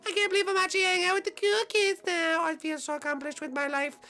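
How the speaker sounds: high, fast, pleading whine; whiny Bowser voice. Acted. Bowser begging the others to invite him, high whiny plea. Boozer happy with cool kids.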